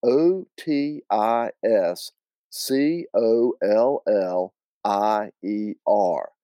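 slow, letter by letter; deliberate Southern spelling. Acted. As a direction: spell it out with long drawn letters. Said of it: "spelled his name on it".

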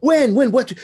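mock flustered. Mock flustered reply to a booking.

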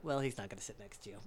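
low, soft, quick brush-off; firm refusal. Retold or imitated. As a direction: shut him down quietly and briskly. Firm refusal to the old man.

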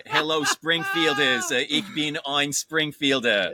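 slow, drawn-out politician addressing a crowd; Mayor Quimby. Acted. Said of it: "Mayor Quimby there".

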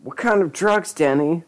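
high, hammed-up sob; sobbing question. A narrator's reading. Lisa asks Denny about drugs, mock sobbing. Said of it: "Lisa sobbed".

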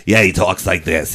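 low-pitched put-on castmate voice; Harvey Fierstein. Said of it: "the guy sounds like Harvey Fierstein".

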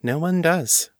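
high, sober mistress voice. A narrator's reading. Mistress answering Morgan's complaint, high and sober. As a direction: soberly concede the point. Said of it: "Ava said soberly".

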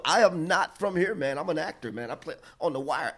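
hammed-up English accent, insisting. Actor protesting to the cop in an English accent. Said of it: "in that English accent".

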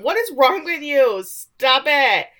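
loud, high outraged objection; alarmed protest. Retold or imitated. Man trapped in the priest's hug, acted alarmed protest. Joe fighting off Father's grip, acted.

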